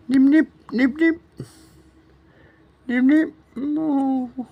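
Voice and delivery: put-on high baby-talk cooing. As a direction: coo it high and sing-song.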